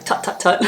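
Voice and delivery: mock disapproving tuts, breathed and unvoiced; voiceless little tuts of disapproval; imitating tutting. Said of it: "there'll be tuts everywhere".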